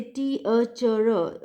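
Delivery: "T, a, ch, r" broken into four separate beats; over-split "T, a, ch, r" as four syllables. Shown as a demonstration. Lecturer showing what we cannot say, split in four syllables. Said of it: "we cannot say there are four syllables".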